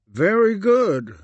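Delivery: forced compliment while appalled. Acted.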